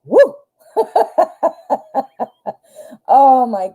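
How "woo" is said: whoop and laugh. Real, not acted. Host fired up at the end, whooping and laughing. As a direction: let it all out with a whoop. Outburst after getting worked up.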